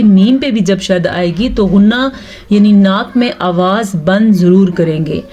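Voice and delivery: ordinary talk, nothing marked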